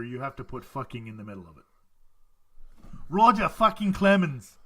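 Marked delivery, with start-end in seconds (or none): 3.1-4.5 slow, loud Boston attempt drifting Australian; bad Boston accent. Shown as a demonstration. Host demonstrating the ballplayer-name game, slow bad Boston accent. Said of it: "you say it with a Boston accent".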